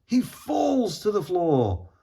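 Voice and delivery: ordinary delivery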